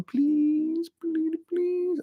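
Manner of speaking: high, slow pleading whine; hammed-up high begging please; mock begging. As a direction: beg pitifully, tongue in cheek. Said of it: "if I were begging, I'd be going".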